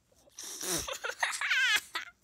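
shrieking burst of laughter; helpless high cackle; loud high laugh. Real, not acted. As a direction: crack up loudly, high. Laughs at "this kid is.

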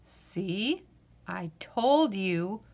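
usual speaking voice

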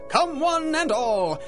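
ordinary talk, nothing marked

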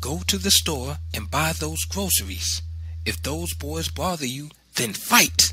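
lightly acted mother laying down orders; ordering mother. A narrator's reading. Mother sending son out to fight, firm orders.

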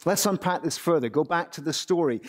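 normal talking voice

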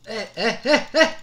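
high chuckling bursts; giggling. Real, not acted. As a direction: laugh in short bursts. Laughing during the fight. (γελαστά κοφτά γελάκια)

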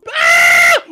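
high, loud startled shriek; startled scream. Real, not acted. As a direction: shriek in sudden fright. Startled by kitty appearing.

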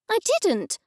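sharp, high-pitched retort; snapped. A narrator's reading. Mother answering her children's accusation, snapping. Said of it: "I snapped".

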